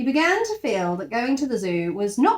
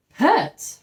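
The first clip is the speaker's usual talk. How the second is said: incredulous echo; 8 semitones higher, 7 dB louder than usual. Acted. Jonathan echoes gatekeeper in disbelief.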